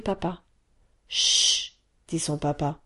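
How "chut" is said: whispered chut, frowning; hushing shh. Acted. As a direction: hush her sharply under breath. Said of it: "en fronçant les sourcils".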